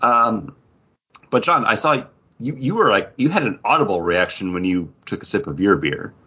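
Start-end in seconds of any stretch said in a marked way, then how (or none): none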